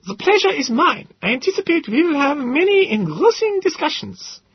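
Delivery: put-on high, lightly Austrian-accented professor; a slightly accented, prim eccentric. A narrator's reading. Gödel welcomes the narrator in a high, accented voice. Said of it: "lightly accented".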